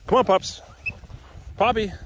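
high, loud call to the dogs; yelling. Real, not acted. Calling puppies to come along on a walk, yelling high. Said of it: "Sorry for yelling".

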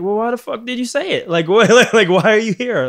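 high, loud, incredulous outburst; mock outrage. Host snapping back at a guest who wants cuts, mock outraged. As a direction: throw the question back in disbelief.

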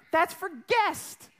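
high, drawn-out mock-outraged mother; scolding mom. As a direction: stretch it out like an appalled mom. Mother guarding cake for guests.

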